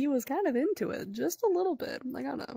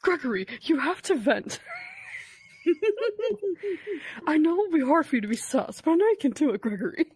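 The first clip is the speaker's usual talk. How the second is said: hammed-up pep talk; 6 semitones higher, faster than usual. Friend voicing Freddy urging Gregory to use the vents. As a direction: cheer him on like a cheesy coach.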